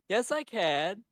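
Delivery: put-on high, whiny kid retort. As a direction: answer back like a small child. Acting Stripe's reply.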